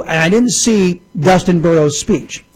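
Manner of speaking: ordinary delivery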